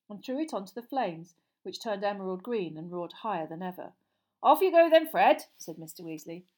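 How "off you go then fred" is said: loud call. Acted. Father sending son off.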